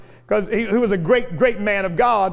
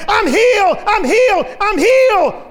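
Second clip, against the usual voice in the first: high loud joyful cries; joyful shouting; 9 semitones higher, 5 dB louder than usual. Retold or imitated. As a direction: shout it out in joy. Healed man running, shouting joyfully.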